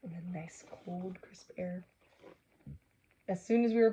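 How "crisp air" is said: ordinary delivery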